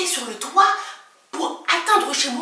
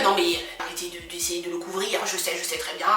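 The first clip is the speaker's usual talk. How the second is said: imitating the neighbour; 7 semitones lower, 6 dB quieter than usual. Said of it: "Il me dit".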